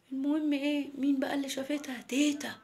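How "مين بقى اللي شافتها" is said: plain reading voice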